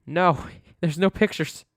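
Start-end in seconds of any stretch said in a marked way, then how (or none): none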